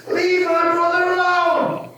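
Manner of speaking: high, slow shout, imitating a sister's scream; hammed-up scream, sister protecting her brother; retold scream. Sister jumping in front of the attacker, imitated high scream. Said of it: "screamed at the top of her voice".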